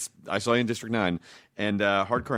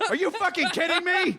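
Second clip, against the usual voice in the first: incredulous. Retold or imitated. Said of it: "you're like".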